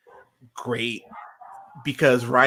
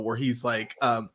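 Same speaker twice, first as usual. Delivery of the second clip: normal talking voice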